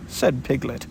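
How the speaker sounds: ordinary delivery, nothing marked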